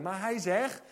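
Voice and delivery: usual speaking voice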